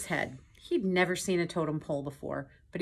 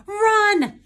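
very high, loud scream to flee; Piglet's panicked cry; 12 dB louder than usual. A narrator's reading. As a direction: scream the warning. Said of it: "cried Piglet".

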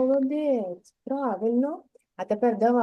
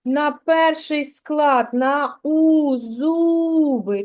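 slow, clear teacher voice, зуби with stress on зу; на у, зуби, each word set apart slowly; stressed first syllable; 3 semitones higher, 4 dB louder, much slower than usual. Shown as a demonstration. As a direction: spell out the stress slowly for a child. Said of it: "На який склад падає наголос".

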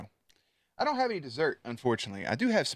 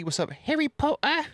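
silly high voice; 3 dB louder than usual. Pretend. Greeting chat in silly voice.

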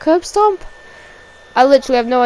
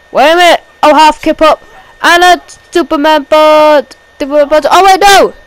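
loud, higher surprised move calls; excited play-by-play; 4 semitones higher, 10 dB louder than usual. Real, not acted. Commentator calling a Superman punch, excited shout. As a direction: call the punch with a jolt.

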